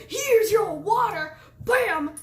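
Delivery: loud, high, slow mock slam; hammed-up careless splash of water; playful exaggerated brute; mock rough shove. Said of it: "You don't wanna go".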